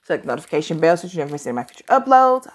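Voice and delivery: rushed, rapid-fire delivery; hurried run of words; talking really fast. Real, not acted. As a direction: rattle it off very quickly. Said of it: "I know I'm talking really fast".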